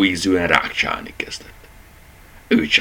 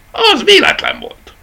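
much higher and louder, with a laugh; irritated snap; 7 dB louder than usual. A narrator's reading. Rabbit snaps back at the dachshund, irritated. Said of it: "vágta rá a nyúl ingerült".

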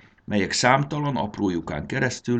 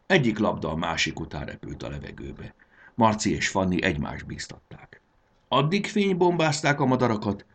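usual speaking voice

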